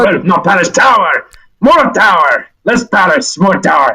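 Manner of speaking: high, hearty Russian creature voice; heavy Russian accent. Acted. Tower creature welcoming Sal, heavy Russian accent. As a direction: greet them in thick Russian-accented English. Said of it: "So a deep Russian voice".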